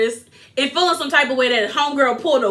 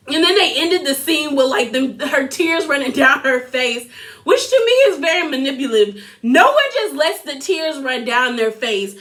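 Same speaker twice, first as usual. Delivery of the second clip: normal talking voice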